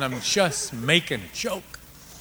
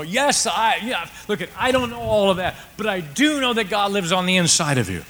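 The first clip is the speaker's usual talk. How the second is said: preaching at full force; 6 semitones higher, 6 dB louder than usual. Acted. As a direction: drive the point home to the church.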